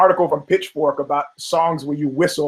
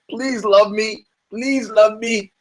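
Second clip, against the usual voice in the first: pleading fighter; 5 semitones higher, slower than usual. Retold or imitated. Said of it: "Arnold Allen is like".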